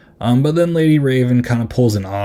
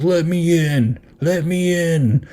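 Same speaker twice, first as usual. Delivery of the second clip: mock frustrated plea; 7 semitones higher, slower than usual. Killer knocking at locked door.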